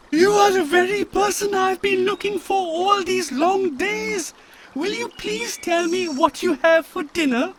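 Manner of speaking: put-on very high breathless child; eager child voice. A narrator's reading. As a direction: gush like an eager small child. Elephant's child speaks eagerly.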